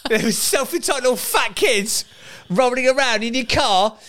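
lightly voiced sneering troll, high and slow; a hint of a snide commenter drawing it out; mocking online troll. Dad parodying online trolls in a high, slow sneer. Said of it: "Before the trolls get onto us, going".

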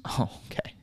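soft whispered resignation; hushed, resigned okay; whispered reply. Acted. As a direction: whisper it like a cashier who's given up. Cashier humoring a customer.

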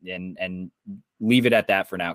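ordinary delivery

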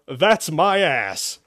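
slow, loud, grinning shout; shout with smile. Acted. Zack jokes to the demon in the jar, shouting happily. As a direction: shout it proudly, drawing it out. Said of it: "he shouted with a smile".

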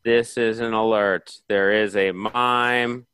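slow, low, loud alert broadcast; emergency alert. Acted. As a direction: read like a siren warning.

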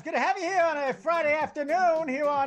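high, loud sports radio rant; Mad Dog voice. Retold or imitated. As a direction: crank it way up like a shouting radio host. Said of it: "my best, um, Mad Dog impression right now".

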